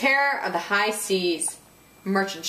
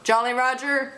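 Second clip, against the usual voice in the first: ordinary delivery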